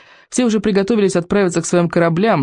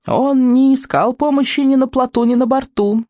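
put-on quavering old man shout; 8 semitones higher than usual. A narrator's reading. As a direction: proclaim it like a frail old elder. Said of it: "торжественно выкрикнул старый сморчок своим дряхлым дребезжащим голосом". (дребезжащий старческий выкрик)

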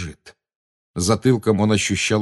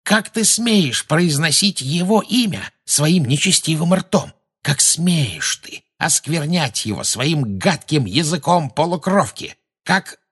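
high, drawn-out indignant tirade; slow, high-pitched fury; shrieking outrage; 8 semitones higher, slower than usual. A narrator's reading. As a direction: rail at the blasphemer in a high voice. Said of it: "взвизгнула Белатриса".

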